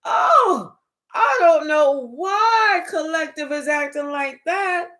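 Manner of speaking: a high Oh, then slow, fake-innocent denial; mock someone pretending to be shocked; sarcastic imitation of fake surprise; acting shocked. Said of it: "they acting shocked like".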